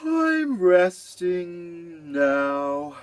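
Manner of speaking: very slow drowsy drawl; sleepy voice. Shown as a demonstration. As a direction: stretch it out half asleep. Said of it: "like this".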